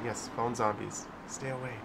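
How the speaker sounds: ordinary talk, nothing marked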